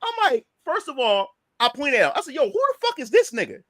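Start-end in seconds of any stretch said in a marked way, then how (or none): 2.3-3.6 high-pitched, incredulous demand; retold indignant challenge. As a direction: call him out, pitch raised in disbelief.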